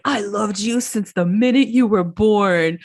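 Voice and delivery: slow, drawn-out mock declaration; lightly put-on voice of an upset uncle; imitated emotional plea. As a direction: stretch it out like a heartfelt protest. Acting out Joe's emotional plea.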